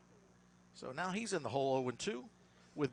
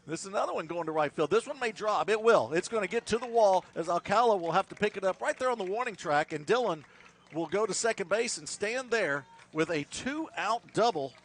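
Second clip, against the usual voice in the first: excited play-by-play; 5 semitones higher, 8 dB louder than usual. Real, not acted. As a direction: build the call as the ball carries. Home run call on deep drive.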